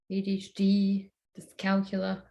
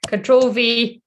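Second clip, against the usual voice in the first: louder, exasperated retry of Control V; frustrated; 4 semitones higher, 7 dB louder, slower than usual. Real, not acted. Streamer fails to paste on live stream, louder and frustrated. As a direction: snap the keys out in frustration. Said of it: "No, guys, this is not working".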